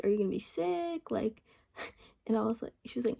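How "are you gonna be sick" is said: higher-pitched questioning rise at the end; imitated probing question. Boss asking employee why she will be absent, imitated high question.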